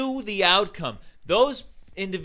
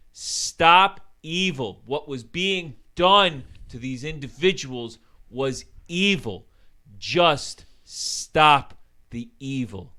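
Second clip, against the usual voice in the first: slow emphatic plea; 4 semitones lower, slower than usual. Real, not acted. Closing rant plea.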